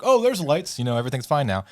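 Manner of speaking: put-on breezy all-clear; tour guide. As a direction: act the guide announcing all is fine. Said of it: "tour guide's like".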